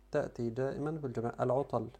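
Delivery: normal talking voice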